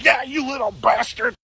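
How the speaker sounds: high, squeaky mock old man cursing; cranky grandpa. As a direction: snarl it like a grumpy old man. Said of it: "my grandpa would be like".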